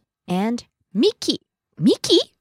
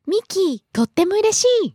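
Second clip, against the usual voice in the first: high, fast cutesy gush; cutesy girl voice; 8 semitones higher, faster than usual. Retold or imitated. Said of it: "Miki-chan said".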